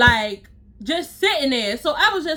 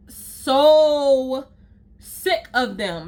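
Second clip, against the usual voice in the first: drawn-out disgust; much slower than usual. Real, not acted. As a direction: drag it out, fed up. Rant about stylists, repeated phrase.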